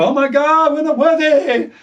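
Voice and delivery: high hammed-up awe; mock grovelling crowd. Dothraki realising their mistake, high mock awe. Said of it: "just as they're about to go".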